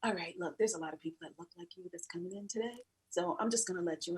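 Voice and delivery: a hint of a low, hushed brush-off; lightly voiced desk man. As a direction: mutter him waving her past.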